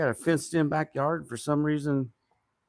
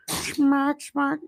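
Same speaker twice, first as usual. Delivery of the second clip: high smack smack; 12 semitones higher, 4 dB louder than usual. Retold or imitated. Imitating tendons smacking.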